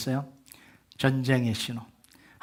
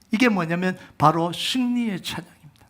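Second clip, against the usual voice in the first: usual speaking voice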